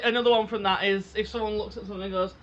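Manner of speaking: normal talking voice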